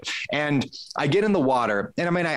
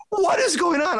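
very high, incredulous squeal; retold amazement. Swimmer to himself while passing people, amazed. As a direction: squeal it high in mock disbelief.

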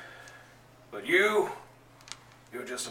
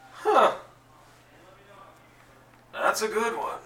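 much higher, louder and amused; amused surprise; 10 semitones higher, 6 dB louder than usual. Acted. Lobo admiring the punch through his chest, high amused. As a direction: pop up high, impressed despite the fist. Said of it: "And he goes".

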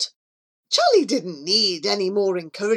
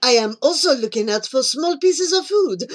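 put-on eager French-accented pigeon; Antoine; 3 semitones higher, 3 dB louder than usual. Antoine telling Charlie his interest in food, eager. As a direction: carry on the brash French patter.

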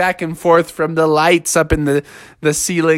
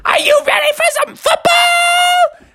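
high-pitched hyped-up party cry; rally the crowd in a high squeal; excited shout; slower than usual. Acted. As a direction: whoop it up like a football fan. Host frog hyping the party.

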